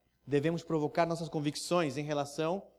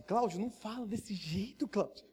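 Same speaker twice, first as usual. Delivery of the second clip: softer, urgent aside; hushed warning; 4 semitones higher, 5 dB quieter than usual. Pretend. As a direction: tug his sleeve with your voice. Friend hushing a bold preacher.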